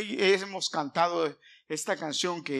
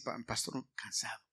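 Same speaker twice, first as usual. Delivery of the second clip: weary voice; 8 semitones lower, 10 dB quieter than usual. Pretend. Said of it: "me puedes decir".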